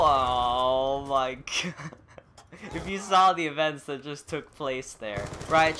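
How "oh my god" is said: long, drawn-out laughing exclamation; slow, laughing groan of disbelief. Real, not acted. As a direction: stretch it out into a laugh. Laughs after frantic game events.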